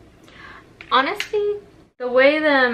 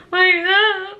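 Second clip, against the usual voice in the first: panicked cry; 8 semitones higher, 5 dB louder than usual. Retold or imitated. Panic peak.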